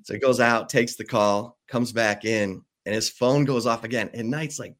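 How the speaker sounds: ordinary talk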